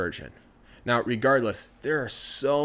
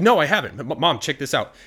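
higher and louder, indignant denial; loud protest; 4 semitones higher, 7 dB louder than usual. Acted. The boy loudly denies to his mom that he's been drinking. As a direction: defend yourself, stumbling.

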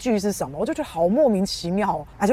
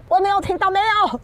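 high, taunting fake denial, louder; mocking the critics; 11 semitones higher, 3 dB louder than usual. Mocking the Taliban critics, a high taunting denial. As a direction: put on a silly voice pretending not to hear. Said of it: "只有塔利班不算".

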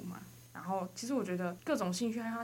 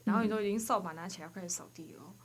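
ordinary talk, nothing marked

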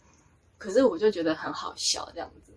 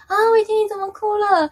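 high, loud panicked cry of concern; flustered alarm; 12 semitones higher, 8 dB louder than usual. Retold or imitated. Angels alarmed to find her crying, flustered. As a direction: imitate a fluster, voice shooting up. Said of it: "天使他们就慌了".

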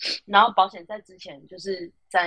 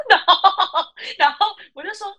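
higher, words broken by laughter; laughing through words; 10 semitones higher, 4 dB louder than usual. Real, not acted. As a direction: keep talking while still laughing. Still laughing while narrating. (边笑边说)

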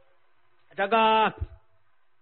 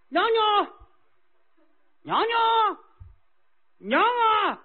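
high, long calls into the distance; calling out; 11 semitones higher, much slower than usual. Acted. King calling for his consort, long high cries. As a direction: call out for the consort, high and drawn out. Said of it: "齐宣王坐在马上喊".

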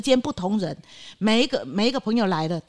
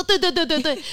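eager; 7 semitones higher than usual. Retold or imitated. Eagerly greeting first stranger guest.

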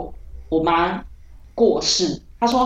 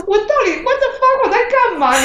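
high, laughing outburst of confusion; baffled outburst; 4 dB louder than usual. Retold or imitated. Waking up to find her hand like a phone, laughing outburst. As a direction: squeal it out, laughing at yourself.

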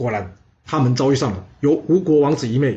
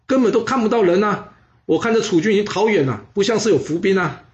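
cocky; 7 semitones higher than usual. Acted. Rash prince dismisses danger.

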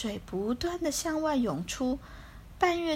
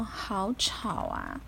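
sleepy voice; 4 semitones lower, 4 dB quieter, much slower than usual. Acted. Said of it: "福龟揉着惺忪的眼睛说".

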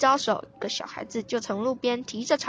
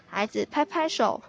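ordinary delivery, nothing marked

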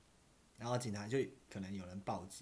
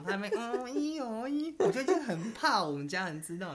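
flustered; 11 semitones higher, 7 dB louder than usual. Real, not acted. Panicked retelling.

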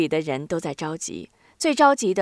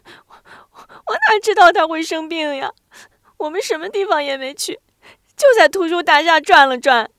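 higher, louder tearful protest; crying; 10 semitones higher, 9 dB louder than usual. Acted. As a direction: sob out the defence, stammering. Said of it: "哭着说".

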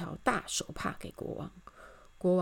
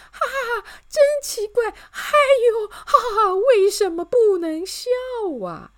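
very high, loud laughing voice; giggling laughter; 11 dB louder than usual. A narrator's reading. Miss Optimist finds the ban strange, giggling. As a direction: laugh right through the words. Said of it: "乐观小姐嘻嘻哈".

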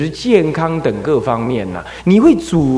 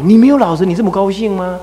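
high, loud, a scolding mother; mother's loud rebuke, pitched up; retold scolding; 7 semitones higher, 4 dB louder, faster than usual. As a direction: scold the boy sharply. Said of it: "那他就骂他说".

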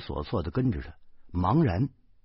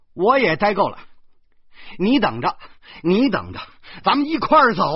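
loud, high, fed-up yell; worked-up husband yelling, building higher; frantic shouting; 10 semitones higher, 8 dB louder than usual. A narrator's reading. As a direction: shout after someone storming off. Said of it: "我急了".

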